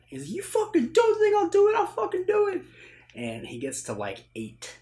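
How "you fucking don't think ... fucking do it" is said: very high, loud retold shouting; acted desperate fury. Acting Ethan after Bridget is shot, furious shout. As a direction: yell it as a frantic threat. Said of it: "when things become real for him".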